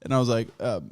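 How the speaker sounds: ordinary talk, nothing marked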